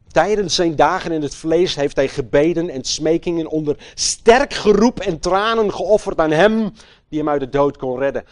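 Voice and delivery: loud, high sermon voice; fiery preaching. Acted. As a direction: preach it with full force. Preaching at full force.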